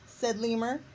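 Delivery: usual speaking voice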